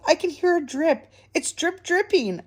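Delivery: high-pitched worried little voice; little mouse. A narrator's reading. Little Mouse reporting the drip, high voice. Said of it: "he called".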